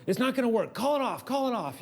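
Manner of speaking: mock panic, pleading to abort; panicked plea. Wife panicking while running for car.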